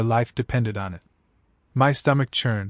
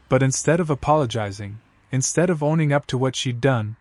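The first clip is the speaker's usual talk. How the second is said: ordinary delivery, nothing marked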